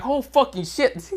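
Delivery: high, slow gasp of awe; stunned guys. Acted. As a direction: let the shock drag out. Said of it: "All the guys are like".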